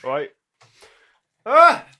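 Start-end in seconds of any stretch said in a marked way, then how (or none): 1.4-1.8 exasperated cry. Real, not acted.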